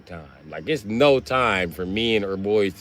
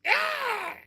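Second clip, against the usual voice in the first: mock women yelling; much slower than usual. Women yelling at him over his morning breath, falsetto shout. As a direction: yell like an annoyed woman in a falsetto. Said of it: "Women be like".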